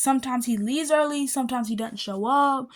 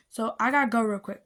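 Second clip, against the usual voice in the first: usual speaking voice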